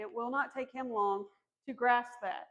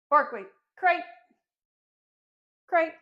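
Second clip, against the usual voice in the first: louder command to a puppy; firm dog command; 5 semitones higher, 8 dB louder than usual. Real, not acted. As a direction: send the dog in firmly.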